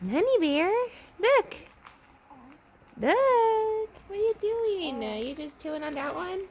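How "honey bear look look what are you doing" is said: high cooing call. Real, not acted. Playful talk to baby.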